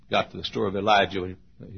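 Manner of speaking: ordinary talk, nothing marked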